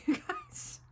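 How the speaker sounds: high squeaky laugh through the words; giggling embarrassed aside. Real, not acted. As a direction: laugh it off at yourself. Laughing at her own admission.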